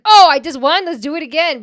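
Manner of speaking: loud, high, giddy winner; excited gambler. Retold or imitated. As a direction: cheer like you just won big. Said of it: "You know that when you're like".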